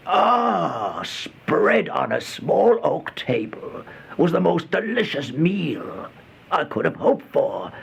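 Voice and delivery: high, slow gasp of wonder at the meal; starving man's awestruck cry at the table; excited cry. A narrator's reading. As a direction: let the hunger break out of you. Starving man sees a meal.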